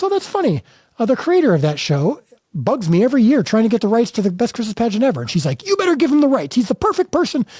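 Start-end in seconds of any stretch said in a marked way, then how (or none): 0.0-5.2 amused rights holder voice. Retold or imitated. Rights holder replies to mom. 5.7-7.5 much higher mock mom voice; insistent mom. Mom insists on giving rights.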